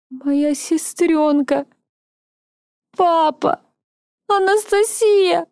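высокие протяжные рыдающие выкрики; медленно, срываясь на плач; отчаянный плач. Acted. Героиня оплакивает семью. (отчаянный плач)

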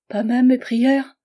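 slow high pleading question. A narrator's reading. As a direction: beg him, slowly, as a last hope. Charlotte begs him to stop.

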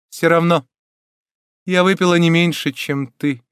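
high, loud start settling into grim triumph; grim joy. A narrator's reading. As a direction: declare it with dark satisfaction. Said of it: "отвечала с мрачной радостью".

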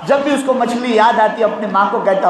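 normal talking voice

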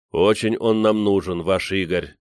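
ordinary delivery

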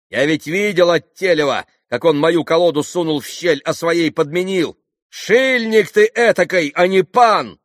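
high, loud, indignant accusation; angry denunciation. A narrator's reading. Landlord denounces the pan's card switch, angrily. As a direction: denounce him before the whole room.

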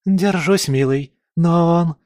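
high, strained female character voice; touch of fear. A narrator's reading. Partner answers under attack, notes of fear. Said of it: "услышал в нём нотки страха".

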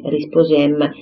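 ordinary delivery

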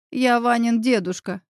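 ordinary delivery, nothing marked

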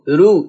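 "رو" with a thin, light r. Shown as a demonstration. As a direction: say "رو" without darkening the ra. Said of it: "سامعين صوتي؟".

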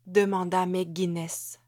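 usual speaking voice

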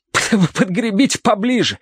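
high, stuttering through water; spluttering request. A narrator's reading. Terence in the river asks for the boat closer, spluttering. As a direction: ask while spluttering and spitting water. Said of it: "отплёвываясь".

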